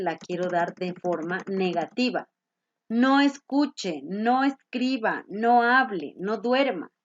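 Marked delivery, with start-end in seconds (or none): 2.9-5.2 NO stressed, drawn out slowly. Shown as a demonstration. Teacher stressing no for the class. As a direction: lean slowly on each no. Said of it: "aumentar esto".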